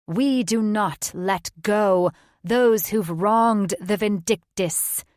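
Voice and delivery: forceful drawn-out chant of the sisters; witches in unison. A narrator's reading. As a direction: pronounce it slowly like a coven's decree. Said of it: "they said in unison".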